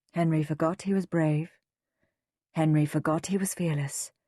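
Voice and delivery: plain reading voice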